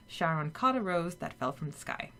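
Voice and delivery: usual speaking voice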